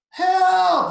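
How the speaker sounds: high, loud yelp; cry for help. Acted. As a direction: shriek for help. Kid stuck on dinosaur tail.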